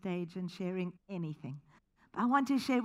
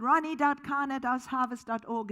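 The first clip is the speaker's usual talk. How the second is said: higher and louder, eager; enthusiastic blurt; 5 semitones higher, 6 dB louder than usual. Retold or imitated. Speaker answers the crowd of kids, eagerly blurting it out. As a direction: blurt out the address with eager excitement. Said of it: "in my enthusiasm".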